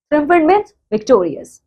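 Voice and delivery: usual speaking voice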